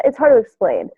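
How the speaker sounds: normal talking voice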